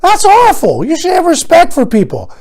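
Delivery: high, pearl-clutching indignation; mock prim outrage. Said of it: "And I start thinking".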